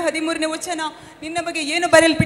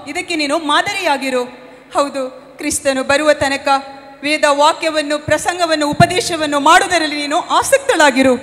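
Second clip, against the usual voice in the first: louder preaching exhortation; 5 dB louder than usual. Acted. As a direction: urge her daughter on like a preacher. Mother preaching to her daughter.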